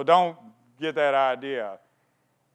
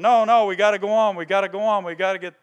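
urgent; 5 semitones higher, 6 dB louder, faster than usual. Retold or imitated. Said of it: "they're going".

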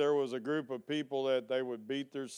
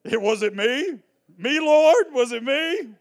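mock innocent; 10 semitones higher, 9 dB louder than usual. Mock-innocent disciple asking the Lord.

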